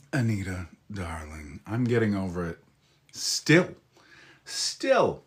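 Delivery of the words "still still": high, whiny "Still" said twice; mock whine. To chat about his lingering cold, a high mock whine. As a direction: whine it like a spoiled child.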